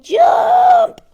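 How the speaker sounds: very high, loud shout; an exaggerated gleeful shout; shouted jump. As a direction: yell it like the captain. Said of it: "there's Earl going".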